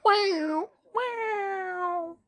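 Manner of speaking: a high, drawn-out mock wow; hammed-up amazement. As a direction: gasp it out in exaggerated awe. Mock awe at film detail.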